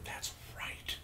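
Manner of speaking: hushed, voiceless realization; awed whisper. Real, not acted. Friend suddenly remembering the film's villains, awed whisper. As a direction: whisper it as the memory lands.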